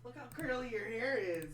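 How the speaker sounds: high, loud cooing at a dog; pet talk. Real, not acted. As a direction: gush over a beloved pet.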